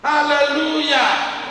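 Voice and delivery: slow, high, loud hallelujah; drawn-out hallelujah. Real, not acted. Preacher crying hallelujah to the church, slow and loud. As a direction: stretch out a joyful hallelujah.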